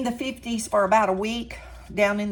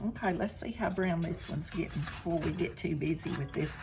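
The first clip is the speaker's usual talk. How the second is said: ordinary talk, nothing marked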